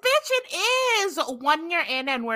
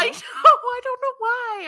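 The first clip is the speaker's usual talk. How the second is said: high squeaky voice; 14 semitones higher, 6 dB louder, slower than usual. Pretend. Laughing at her own shtick.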